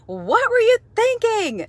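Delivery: high, loud, slow exasperated yell; coach yelling. Retold or imitated. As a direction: drag it out like an angry coach. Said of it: "had a coach scream at us and yell that".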